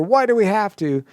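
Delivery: high whiny protest; exclaimed, exasperated complaint; stating why. Shown as a demonstration. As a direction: object to the rule, don't ask. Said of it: "if I'm stating why".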